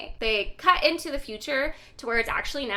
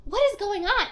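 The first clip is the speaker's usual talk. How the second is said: higher, louder "what is going on"; alarmed outburst; 8 semitones higher, 6 dB louder than usual. Retold or imitated. Walter blurts out his bewilderment to the crew, higher and louder. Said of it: "then he's like".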